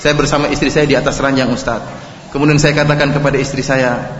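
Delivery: low, soft anxious confession; regretful husband confessing to the preacher; worried questioner. Retold or imitated. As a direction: read the message low and hushed like a nervous husband. Sampai ada yang S M S.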